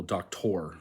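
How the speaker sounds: "doctor" as Spanish doc-TOR; last-syllable stress. Shown as a demonstration. Learner giving the Spanish example, stress on the last syllable of "doctor". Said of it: "are stressed on the last syllable".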